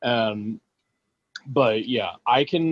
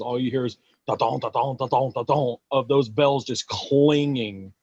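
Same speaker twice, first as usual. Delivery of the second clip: bell sound imitation. Imitates Krampus bells.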